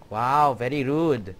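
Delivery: normal talking voice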